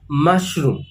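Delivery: mushroom said slowly with stress on MUSH; first syllable MUSH stressed, falling tune; teacher models mushroom, stress on MUSH; model word. Shown as a demonstration. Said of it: "the stress is on the first part of the word".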